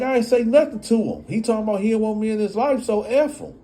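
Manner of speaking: high, fast angry comeback; mock angry father; heated retort. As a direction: snap back at her.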